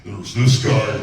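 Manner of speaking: loud, heavy brute voice set against the second; a loud brute calling out; first monster voice. Shown as a demonstration. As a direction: bellow it out like a big brute. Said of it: "Hulk and Grundy are a good example of that".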